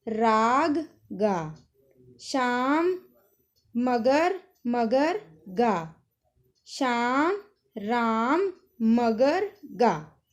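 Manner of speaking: loud, steady word-by-word reading aloud; reading drill. Shown as a demonstration. Teacher reading the word list aloud to pupils, loud and deliberate. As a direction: read each word out clearly like a class drill.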